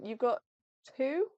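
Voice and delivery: ordinary delivery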